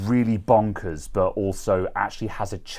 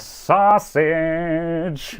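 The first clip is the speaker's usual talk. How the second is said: mock announcer cry; 9 semitones higher, 4 dB louder, much slower than usual. Mock intro of sausage show.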